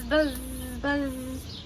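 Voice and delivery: ordinary delivery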